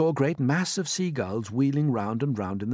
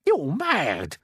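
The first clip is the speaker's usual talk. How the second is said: raised, protesting exclamation; cried out; 7 semitones higher, 4 dB louder than usual. A narrator's reading. Earthworm reacting to James's plan, crying out. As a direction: cry out in protest, higher. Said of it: "cried the earthworm".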